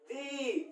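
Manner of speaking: दी said as one soft voiced "the" syllable; sound name demo. Shown as a demonstration. Teacher giving the second letter name to students, softly. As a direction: breathe out दी quietly as a single voiced sound. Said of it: "दूसरा नाम देंगे".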